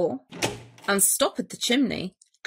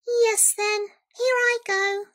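high, dainty little voice; obliging mouse; 10 semitones higher than usual. A narrator's reading. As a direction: squeak a polite leave-taking. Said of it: "said the Mouse".

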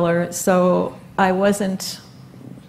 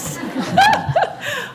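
brief embarrassed laugh; a short laugh through tears; light laugh. Real, not acted. Laughs at being handed Kleenex.

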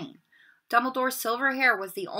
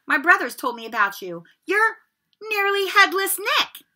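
higher, louder, rising excitement; eager boy; 9 semitones higher, 7 dB louder than usual. A narrator's reading. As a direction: show off what you know. Ron naming the ghost.

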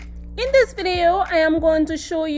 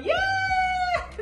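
very high gleeful yay; excited cheer; 4 dB quieter than usual. Real, not acted. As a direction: squeal it out with joy. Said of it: "I am super excited".

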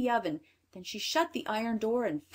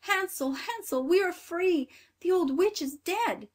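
put-on high, excited girl calling out; joyful cry; 10 semitones higher, 5 dB louder than usual. A narrator's reading. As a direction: cry out the great news, pitched way up.

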